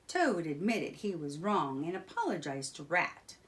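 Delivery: ordinary delivery, nothing marked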